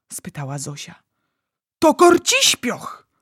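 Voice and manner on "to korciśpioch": high, loud, drawn-out wail of anger; anguished anger. A narrator's reading. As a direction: cry it slowly in fury and torment. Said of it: "z gniewem i udręką w głosie".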